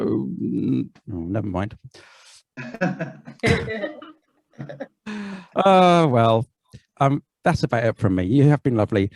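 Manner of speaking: ordinary talk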